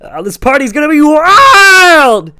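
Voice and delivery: very high, loud American whoop; hyped loud American bro yelling; rowdy party shout. A narrator's reading. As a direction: shout it out like a hyped frat boy. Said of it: "the fat guy nodded in approval".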